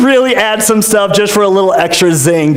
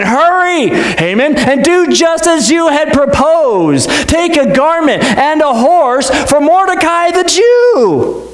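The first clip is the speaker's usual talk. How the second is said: high, slow royal order; commanding king; 8 semitones higher, slower than usual. Acted. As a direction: hurry him along as a king.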